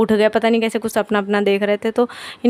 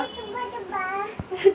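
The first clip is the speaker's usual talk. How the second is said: a short high-pitched giggle; quick bright laugh at herself; 11 semitones higher, 9 dB quieter than usual. Real, not acted. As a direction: giggle lightly at the camera. Giggles while filming.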